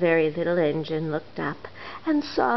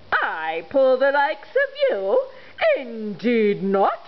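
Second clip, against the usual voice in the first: slow, scornful engine voice; haughty refusal; 4 semitones higher, slower than usual. Acted. Proud engine snubbing the little toys, scornful. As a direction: look down your nose at the toys.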